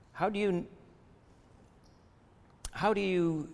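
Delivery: ordinary talk, nothing marked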